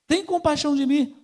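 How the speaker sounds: imitating a beggar's cry over a crowd; Bartimeu crying out. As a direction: call out for mercy, pitched well up. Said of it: "ele começa a gritar".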